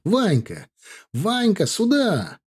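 high-pitched hailing calls; shouting to a friend. A narrator's reading. Soldier hailing his approaching comrade, high shouted call. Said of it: "крикнул".

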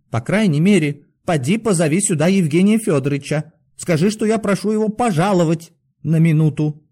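high-pitched, insistent pleading; desperate request. A narrator's reading. Doctor asks for the superintendent to come, pleading. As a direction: beg him urgently, voice kept high.

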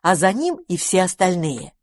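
ordinary delivery, nothing marked

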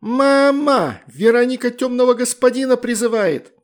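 put-on very high, loud child's tattling yell; yelling for mom. A narrator's reading. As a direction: call out to mom in alarm. Girl calls out to mother.

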